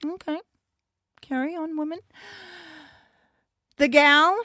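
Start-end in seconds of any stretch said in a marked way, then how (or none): 0.1-2.0 soft, higher-pitched dismissal; unimpressed look voiced. Champion watching two wrestlers point at her title, softly dismissive. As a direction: quietly wave them on, with pauses. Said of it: "just looks at them like".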